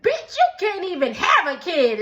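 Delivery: high, loud mock snap; retold insult. Kelly retorting to Britt, loud and high. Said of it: "she said".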